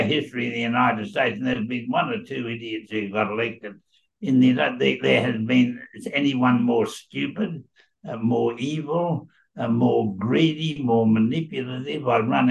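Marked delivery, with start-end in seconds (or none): none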